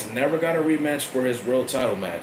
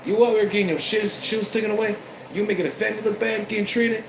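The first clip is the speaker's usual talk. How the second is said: higher, fast scolding rant; higher fast mock Rock tirade; 9 semitones higher than usual. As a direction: chew him out like the old head. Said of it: "I'd say".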